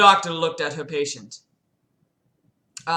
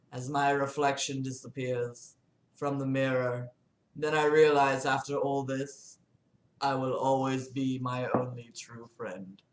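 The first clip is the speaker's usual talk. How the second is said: low, measured, soft reading; story narration; 5 semitones lower, 7 dB quieter than usual. Acted. Reader closing the chapter, low soft narration. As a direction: read it low and hushed, even pace.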